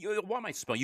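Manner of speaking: usual speaking voice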